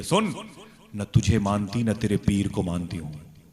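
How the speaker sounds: loud, defiant rejection; acted scolding, forcefully projected; angry wife. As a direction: lay down the law to a husband. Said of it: "मेरी बीवी नाराज हो गई".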